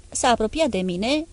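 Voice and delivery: ordinary delivery, nothing marked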